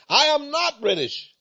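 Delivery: high-pitched insistent "I am not British"; voicing an angry man who hears his DNA says British; retold angry denial. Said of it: "he got mad".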